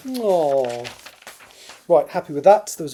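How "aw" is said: usual speaking voice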